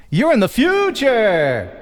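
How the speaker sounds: slow, drawn-out amazement; mock wonder. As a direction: stretch it out in playful awe. Mock wonder at time difference.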